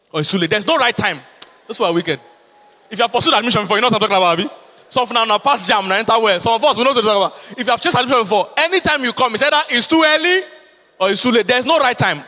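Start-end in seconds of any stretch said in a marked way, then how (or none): 2.9-7.6 louder, higher preaching to the crowd; rally the listeners. Acted. Pastor asking the congregation if they know, preaching louder. 7.6-12.2 higher, louder preached repeat. Real, not acted. Pastor repeating the complaint to the congregation, louder and higher.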